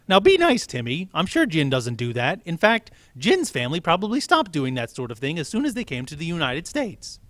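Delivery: high, loud, bright teacher announcement; teacher introducing the new kid; cheery teacher. Acted. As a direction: welcome the class like a chipper teacher. Teacher scolds pupil.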